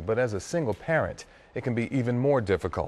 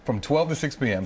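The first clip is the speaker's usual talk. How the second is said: usual speaking voice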